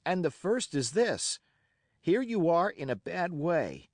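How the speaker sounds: put-on a boy's bold, racing challenge to pirates; excited defiance. A narrator's reading. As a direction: fling it at them all, fired up and fearless. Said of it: "by this time I was quite excited".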